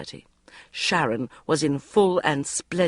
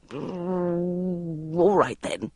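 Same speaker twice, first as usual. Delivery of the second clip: grumpy mutter; much slower than usual. A narrator's reading. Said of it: "I muttered grumpily".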